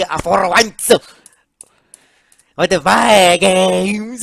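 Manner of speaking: loud, jubilant proclamation; triumphant Luigi. Acted. Luigi celebrating his game's re-release, triumphant. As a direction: proclaim it loudly, swelling on "finally".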